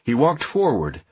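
ordinary delivery, nothing marked

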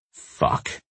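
whispered curse under breath; muttering. Acted. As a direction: hiss it under your breath. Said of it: "I mutter".

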